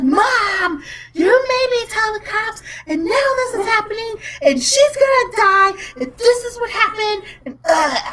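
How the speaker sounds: high-pitched mock tantrum; imitated pissed daughter. Daughter blames mom for telling the cops, upset high mimicry. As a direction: whine it like an upset kid blaming Mom. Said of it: "She got so mad at me. She got so upset. She was pissed".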